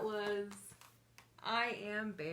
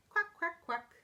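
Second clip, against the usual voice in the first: high, short quacks; happy duckling quack; 6 semitones higher, 4 dB louder than usual. Acted. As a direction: quack lightly like a happy little duck. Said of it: "When I'm feeling happy, I say".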